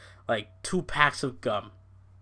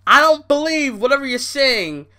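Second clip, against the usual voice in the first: higher and louder, scoffing; loud disbelief; 10 semitones higher, 7 dB louder than usual. Real, not acted. To Stephanie talking herself up, loud scoffing disbelief. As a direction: shout it down in disbelief.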